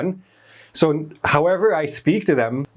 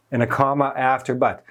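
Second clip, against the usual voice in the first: normal talking voice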